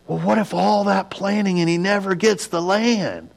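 high-pitched mock fretting; high, exaggerated what-if worry; mock worrier. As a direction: voice a doubter's objection, pitched well up. Voicing a worrier's objection.

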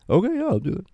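light, high retold reply; imitated keen daughter. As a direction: chirp it like an agreeable daughter.

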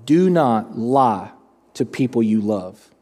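slow, low retold warning with weighty pauses; measured, low-pitched imitation of a serious dad; grave fatherly rebuke. Retelling father's grave rebuke to him.

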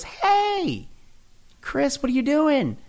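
high, bright-voiced coworker calling over; nosy coworker. Coworker walks up to Chris at his desk, high curious call. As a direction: sing out the question like a curious officemate.

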